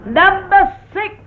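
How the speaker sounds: high, loud, drawn-out bingo call with a laugh. Real, not acted. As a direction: sing the number out across the hall. Bingo caller calling at the club.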